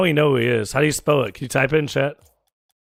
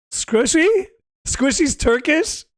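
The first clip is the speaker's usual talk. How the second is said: high-pitched incredulous question; can't believe what they just heard; real disbelief; 10 semitones higher, much slower than usual. Real, not acted. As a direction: say it slowly, voice shooting up in disbelief. Hears a surprising claim about Squishy.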